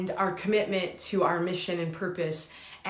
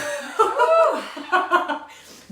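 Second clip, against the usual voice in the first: long high-pitched burst of laughter; 6 dB louder than usual. Real, not acted. Laughing at his own past as a player, a high laugh. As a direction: cackle at yourself.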